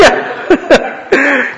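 high breathy giggle; real laughter; laughing. Real, not acted. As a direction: break into laughter. Laughing at own joke.

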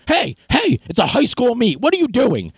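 high-pitched calls of intervening parents; imitating indignant onlookers; mock alarmed protest. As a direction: call out high, as if breaking up a fight. Retold parents calling out to intervene.

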